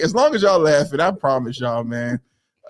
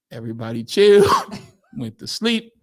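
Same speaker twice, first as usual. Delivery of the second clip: ordinary talk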